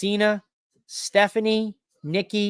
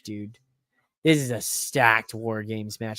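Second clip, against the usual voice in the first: ordinary talk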